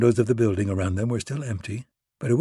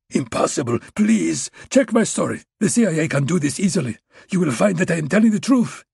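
panicked Russian pleading; 7 semitones higher than usual. A narrator's reading.

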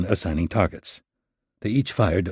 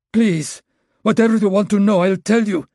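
high, loud, desperate begging; 12 semitones higher, 5 dB louder than usual. Acted. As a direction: beg for it to stop. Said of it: "Maxim begged".

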